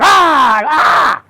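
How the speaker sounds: high, loud double shriek; claws attacking. Acted. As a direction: shriek twice as the pouncing claws. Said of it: "They went like this".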